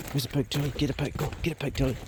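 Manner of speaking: quiet hunting encouragement to the dog; hushed urging. Real, not acted. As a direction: keep it down so the pig won't hear.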